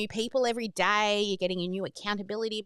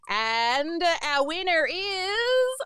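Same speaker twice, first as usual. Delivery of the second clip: slow, higher drawn-out reveal; announcer build-up; 6 semitones higher, much slower than usual. Acted. Host drawing the next winner, slow and higher. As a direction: hold the suspense before the name.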